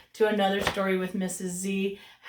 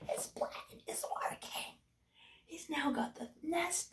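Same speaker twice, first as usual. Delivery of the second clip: sly whisper; 5 semitones higher, 13 dB quieter than usual. Acted. Sly aside about Jack.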